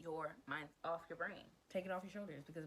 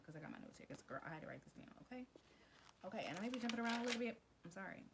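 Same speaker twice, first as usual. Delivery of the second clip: normal talking voice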